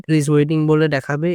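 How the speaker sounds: ordinary talk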